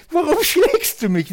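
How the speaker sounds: high, loud cartoon donkey voice; Trickfilm-Esel. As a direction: squeak it out like a cartoon animal. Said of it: "Wie im Trickfilm". (hohe, laute Zeichentrick-Eselstimme)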